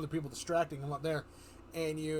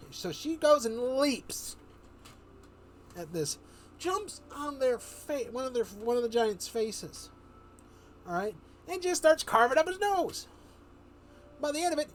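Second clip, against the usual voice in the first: builds higher and louder toward the nose; from lively to thrilled, much higher and louder; rising excitement; 9 semitones higher, 5 dB louder than usual. Real, not acted. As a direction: get carried away telling the big moment. Narrating the gory attack, building.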